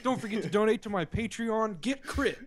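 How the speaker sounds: punchy YouTuber intro; Dante voice. Shown as a demonstration. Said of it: "Let's have a radio voice".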